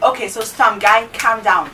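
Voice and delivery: imitating a bossy teacher telling the class to calm down; mock strict teacher. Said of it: "She's already doing this".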